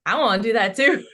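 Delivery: Eric's line laughed out with delight; laughing quote. Retold or imitated. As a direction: giggle through Eric's eager line. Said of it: "Eric is like".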